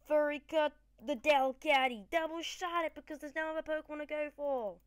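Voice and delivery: loud, high move call; excited battle call. Real, not acted. Gamer calling battle moves, excited and loud. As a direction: shout the attack at the screen.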